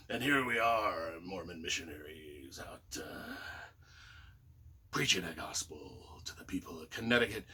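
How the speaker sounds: low cowboy narrator sinking to a whisper; old Western narrator voice. Retold or imitated. Missionaries goofing around town, low Western narrator voice. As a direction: narrate yourselves like a Western hero, fading out. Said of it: "we started talking that way ourselves".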